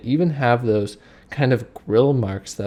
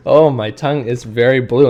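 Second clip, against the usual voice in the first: louder, amazed exclamation; excited; 8 dB louder than usual. Real, not acted. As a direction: marvel at it out loud. Shows blue tongue, amazed.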